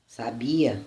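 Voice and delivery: sabia stressed on BI, the middle syllable; stress on second syllable. Shown as a demonstration. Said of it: "Na segunda sílaba, no i, no bi".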